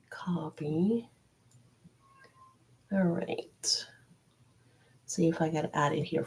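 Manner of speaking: half-whispered self-talk while busy; muttering to herself. Real, not acted. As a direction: trail into a soft, whispery murmur. Murmuring to herself while copying a link.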